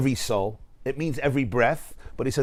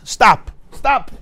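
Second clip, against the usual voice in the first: two sharp, raised exclamations; urgent halt; 7 semitones higher, 6 dB louder than usual. Acted. Teacher urging students to notice each breath, urgent halt. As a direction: call a sudden, emphatic halt.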